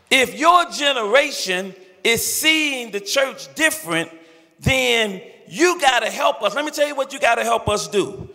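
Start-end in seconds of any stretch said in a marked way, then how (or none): none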